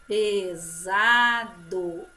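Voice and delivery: "Pesado" loud and slow with a buzzed Z. Shown as a demonstration. Teacher answering which sound it made, Z in "Pesado". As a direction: stress the Z sound inside a stretched "Pesado". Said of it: "Som de Z".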